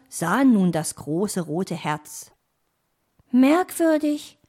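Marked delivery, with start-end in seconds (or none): 3.3-4.4 put-on high, louder young-animal voice; puzzled exclamation. Young fox spots the red heart, high puzzled exclamation. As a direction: let it slip out, high and puzzled. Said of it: "entfuhr es Weißohr".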